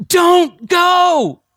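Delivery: high, loud pleading shout; cried out. Retold or imitated. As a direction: yell it to stop him leaving. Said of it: "he cried out".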